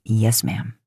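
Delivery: lightly voiced, low playful salute; low, jokey salute line. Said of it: "gives you a playful salute".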